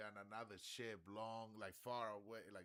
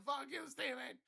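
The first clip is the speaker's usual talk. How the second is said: very high, loud yelled curse; mock yell; 9 dB louder than usual. Guy cursing Steven again, very high yell. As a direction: scream it across the water. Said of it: "he said".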